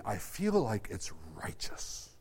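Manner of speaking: whisper. Acted.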